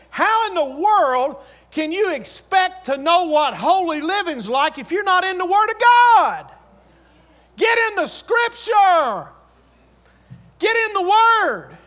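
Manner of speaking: loud, high-pitched sermon exhortation; full-force preaching. Real, not acted. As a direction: preach it hard, drawing out the key words.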